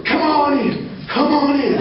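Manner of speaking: high, hollered imitation of a host; hollered welcome. Man hollers to a visitor knocking on his door, hollered welcome. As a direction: call out a high-pitched, drawn-out welcome.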